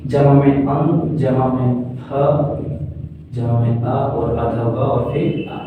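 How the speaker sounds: slow teacher's syllable-by-syllable breakdown. Shown as a demonstration. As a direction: sound by sound, slowly. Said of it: "ध्यान से तोड़े तो देखो".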